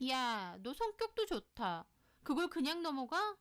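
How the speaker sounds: indignant, high-pitched scolding; annoyed friend. Acted. Friend hears she let it pass, indignant. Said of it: "소희가 좀 화를 내더라고요".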